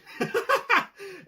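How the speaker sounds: high burst of real laughter; laughing. Real, not acted. Vlogger laughing at his own Cap quote. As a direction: crack up at the joke.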